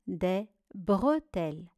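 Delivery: "bretelles" split in two after "bre"; syllable by syllable. Shown as a demonstration. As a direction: dictate "bretelles" with a gap between syllables. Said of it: "Voici les syllabes". (« bretelles » découpé syllabe par syllabe)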